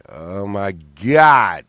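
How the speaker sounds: very slow, loud mock disbelief; mock appalled. Onlooker reacting to mom-pants cowgirls, mock appalled. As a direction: stretch it out, swelling hard on the last word. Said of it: "And you're like".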